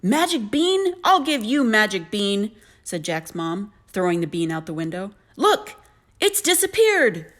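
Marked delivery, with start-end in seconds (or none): 0.0-2.5 loud, high, indignant echo; furious. A narrator's reading. Mother about to throw the bean out, loud furious retort. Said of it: "She was furious". 5.4-7.3 very high, loud, drawn-out taunt; furious mom. A narrator's reading. Mother after throwing the bean out, high angry cry. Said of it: "She was furious".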